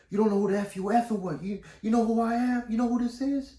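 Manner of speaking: high, heated imitation of an angry man; getting crazy. High customer flipping out at caller, crazed and threatening. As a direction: flip out, pitch climbing with each challenge. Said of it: "He started getting crazy".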